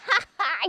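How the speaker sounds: high mocking cackle; cackling laugh. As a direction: cackle like a gloating bird. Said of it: "cackling with her laughter".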